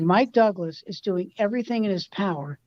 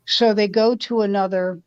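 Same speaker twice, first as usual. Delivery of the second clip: ordinary delivery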